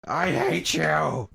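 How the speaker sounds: slow, drawn-out mock whine; imitating a sulky villain moaning from the ground; whiny Anakin. Stumpy villain on the ground, slow mocking whine. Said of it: "crawling down on the ground like".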